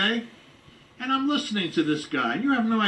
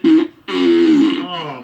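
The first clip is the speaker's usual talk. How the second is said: fart noise; 11 semitones lower, 12 dB louder than usual. Acted. Fart gag again.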